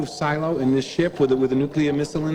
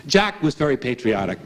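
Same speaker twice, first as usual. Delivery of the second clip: ordinary talk, nothing marked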